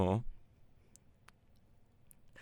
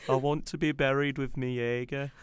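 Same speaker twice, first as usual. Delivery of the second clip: higher-pitched, hammed-up Brummie attempt; bad Brummie accent; 5 semitones higher than usual. Co-host plays friend's last wish, bad Brummie accent. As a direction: attempt Brummie and get it wrong. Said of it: "I can't do a Brummie accent".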